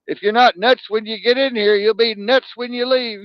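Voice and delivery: high, loud fed-up rant; exasperated outburst. Retold or imitated. Patient ranting at the hospital staff, high loud exasperation. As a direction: blurt it like you're going stir-crazy. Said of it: "I'm like".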